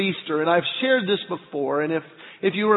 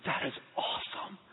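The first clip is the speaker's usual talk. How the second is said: hushed, breathless whisper of awe; awed whisper; 4 semitones lower, 13 dB quieter than usual. Retold or imitated. Dad whispering to his son at a red light, awed. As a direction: whisper it like you can't believe the car.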